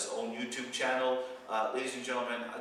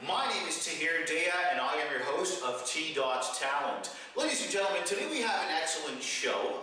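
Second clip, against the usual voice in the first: raised, upbeat announcer welcome; show host intro; 5 semitones higher than usual. Acted. Host greeting viewers at the start, upbeat announcer voice. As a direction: open the show like an MC.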